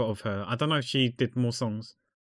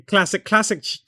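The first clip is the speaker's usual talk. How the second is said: excited; 8 semitones higher, 6 dB louder than usual. Real, not acted. Laughing at her school prank.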